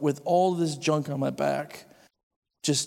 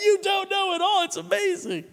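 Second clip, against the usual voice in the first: very high, squeaky, overexcited; high excited squeal. Retold or imitated. Telling the class about his revelation, very high and giddy. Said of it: "I'm like".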